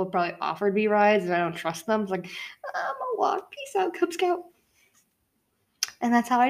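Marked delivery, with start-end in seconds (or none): 2.3-4.4 high, light mock farewell; breezy brush-off. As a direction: toss off a goodbye in a high, soft voice. Breezy refusal of a ride, acted.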